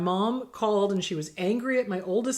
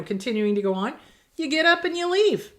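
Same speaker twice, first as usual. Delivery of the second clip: ordinary talk, nothing marked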